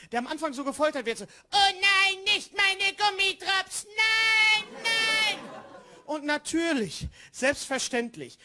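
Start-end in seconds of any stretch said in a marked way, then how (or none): 1.3-5.4 whining tortured gingerbread; Gingerbread Man impression. Gingerbread Man begging while tortured, high panicked voice. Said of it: "spricht er den Lebkuchenmann".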